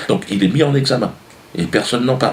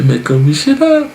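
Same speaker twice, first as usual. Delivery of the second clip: very high, louder fairy chirp; mock fairy; 5 semitones higher, 4 dB louder than usual. Wish fairy presenting herself to sick boy, mock cheery. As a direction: announce yourself as a silly sugary fairy.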